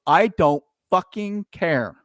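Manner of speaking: slow, deliberate retort with every word weighted; slow, emphatic declaration. Retold or imitated. Billionaire answering talk of angry people, slow emphatic retort. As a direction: stretch out each word for defiance. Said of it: "Let me say that again slowly".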